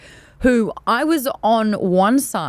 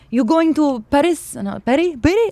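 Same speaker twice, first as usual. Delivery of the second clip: put-on French accent; 5 semitones higher than usual. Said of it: "I'm butchering this whole thing".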